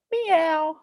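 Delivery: very high imitated meow; cat meow.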